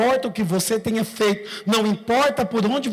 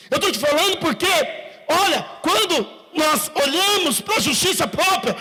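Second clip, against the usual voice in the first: preaching at full force; 5 semitones higher than usual. Acted.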